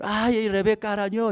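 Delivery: high whine of a hurt child, imitated; mock tearful tattling; crying daughter. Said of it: "papá viene llorando, viene".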